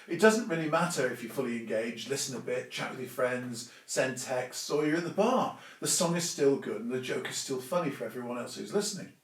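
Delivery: ordinary talk, nothing marked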